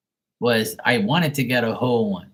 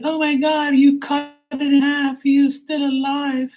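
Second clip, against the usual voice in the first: very high squeaky mock outrage; shrill parody of an upset commenter. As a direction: falsetto caricature of a horrified viewer. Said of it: "they're like".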